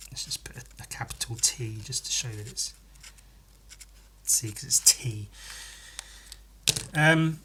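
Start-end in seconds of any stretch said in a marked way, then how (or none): 0.1-5.3 whisper. Real, not acted. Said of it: "whispering to himself while drawing".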